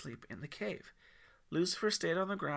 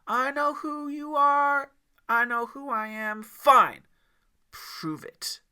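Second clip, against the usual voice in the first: much higher, loud mocking parody of Yeshua; high mocking imitation; 11 semitones higher, 14 dB louder, slower than usual. Devil parroting Yeshua's words, high and mocking. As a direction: mimic him high and sneering. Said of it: "His voice went high and mocking".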